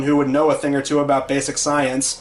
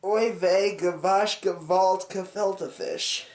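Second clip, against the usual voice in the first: put-on Yiddish accent; 6 dB quieter, slower than usual.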